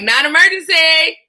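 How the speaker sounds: high, loud, hammed-up singsong pickup; exaggerated phone greeting. Call taker answers the phone, exaggerated high greeting. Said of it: "I didn't say it like that".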